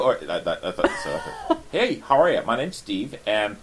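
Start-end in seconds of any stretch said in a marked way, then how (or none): 1.7-3.6 put-on American accent. Volunteer on the door introducing himself, American accent. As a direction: play a friendly American usher. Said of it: "American accent, because the, for me".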